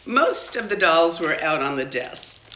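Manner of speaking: usual speaking voice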